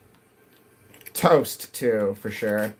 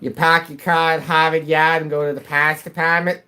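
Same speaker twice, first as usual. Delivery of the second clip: exaggerated Boston-style New England accent, every R dropped; mock the New England accent with broad open A's; 3 semitones higher, 3 dB louder than usual. Streamer mocking how locals talk, exaggerated New England accent.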